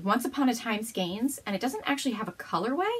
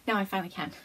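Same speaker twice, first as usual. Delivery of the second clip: normal talking voice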